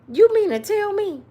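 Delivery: ordinary delivery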